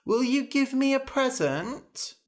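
put-on very high, pleading child voice; wheedling little girl. Princess asking her father for a present, high wheedling. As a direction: beg like an excited daughter.

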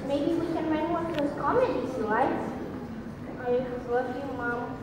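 soft, low, remorseful comforting; tender apology. Acted. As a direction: soothe Mom gently after hurting her. Son consoles crying mother, apologizes.

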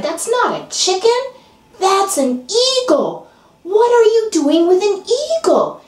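high astonished insistence; can't believe his eyes; indignant friend. Acted. Friend tells the farmer it's an eagle, high and astonished.

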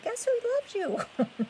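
very high squeaky otter voice. Pretend. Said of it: "That was my best otter voice".

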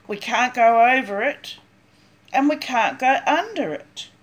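usual speaking voice

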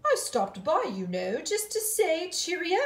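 a breezy cheerio from a dandy; dapper cousin. Acted. Cousin dropping by to greet the girls, jaunty and loud.

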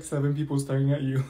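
ordinary talk, nothing marked

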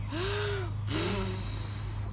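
long wolf inhale huff; big huff. Acted. Wolf huffing.

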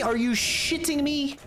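high, slow are-you-kidding-me whine; outraged disbelief. Real, not acted.

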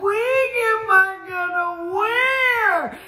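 high, drawn-out panicked wail; very high mock-despairing cry; freaking out. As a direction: wail it out slowly, hammed-up freak-out. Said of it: "so I'm freaking out".

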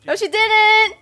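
loud, high denial; indignant. Real, not acted. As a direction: deny it indignantly. Rejecting fortune teller claim.

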